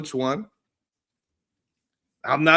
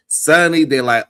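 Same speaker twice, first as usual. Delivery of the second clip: ordinary talk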